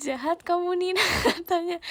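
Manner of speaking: high, loud laughing read; laughing while reading a comment aloud. Real, not acted. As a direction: laugh through the words.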